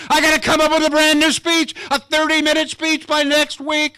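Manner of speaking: high, loud panicked rush; sudden dread about the deadline. Retold or imitated. Panicking over a new speech.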